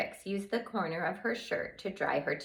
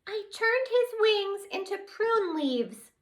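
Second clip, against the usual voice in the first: very high, slow, tearful; tiny fairy crying over her deed; 4 dB louder than usual. Acted. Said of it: "cried some more".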